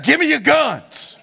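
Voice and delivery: slow, firm repeated order; a hint of a tough demand. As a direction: repeat the command flatly. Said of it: "He's like".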